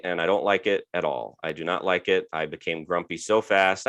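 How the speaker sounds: usual speaking voice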